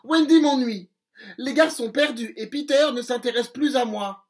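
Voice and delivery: plainte aiguë et plus forte de fée vexée; fée jalouse. Acted. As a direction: se plaindre fort, piquée de jalousie. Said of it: "lui dit-elle". (fée jalouse qui se plaint fort)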